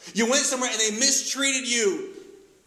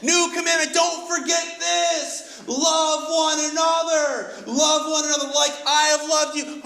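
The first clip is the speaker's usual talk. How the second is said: higher, louder, slow and emphatic, shouting the key line; urgent commandment; 8 semitones higher, 3 dB louder than usual. Acted. Jesus voiced giving the commandment.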